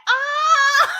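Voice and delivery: high, loud imitation scream, laughing; hammed-up shriek breaking into laughter; mock scream. Host mimicking the actress's scream, high hammed-up shriek. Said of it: "watching [name] scream".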